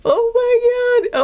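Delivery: high, slow, squirming exclamation; being tickled. Real, not acted. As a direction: react as it tickles your hand. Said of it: "This is too tickly".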